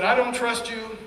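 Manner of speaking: loud, high, sharp yell at the psychiatrist; retold yell. Marine yelling at his new psychiatrist, loud and high. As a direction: bark it at him like the officer who outranks him. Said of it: "I yelled at him and said".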